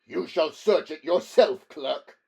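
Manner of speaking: mocking humor. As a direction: taunt him, cruelly amused. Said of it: "inspired to a flight of humor".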